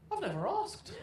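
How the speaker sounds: lightly voiced mum's deadpan confession.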